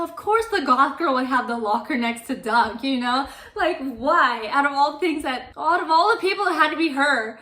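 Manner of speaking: loud, rising indignant complaint; loud, hammed-up "why me" outburst; acted exasperation. Character exasperated at being seen.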